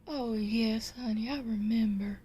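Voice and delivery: put-on woman's voice; fond wife. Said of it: "She says".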